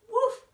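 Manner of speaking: very high, loud yapping woof; dog bark. A narrator's reading. Girl under a spell barks at her parents, yapping. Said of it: "she barked".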